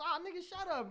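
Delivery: high-pitched retold table banter; animated banter. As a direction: toss it out high, like joking with friends.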